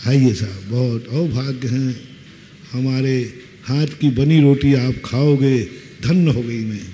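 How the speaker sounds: devoted wife's honeyed welcome; mock devoted wife. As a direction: play the humble adoring hostess. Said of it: "ऐसी पत्नी ने कभी कहा?".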